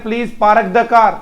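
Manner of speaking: पार्क as "paa-ruk" and car as "kaar", R's sounded; everyday Indian pronunciation. Shown as a demonstration. Teacher shows pupils how we usually say it, R's sounded. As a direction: pronounce the र fully in पार्क and car. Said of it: "हम बोलते हैं".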